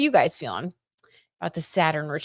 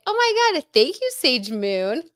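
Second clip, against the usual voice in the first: much higher, thrilled thank-you; delighted squeal; 8 semitones higher, 3 dB louder than usual. Real, not acted. Streamer thanking viewer for outfit compliment, high delighted gush.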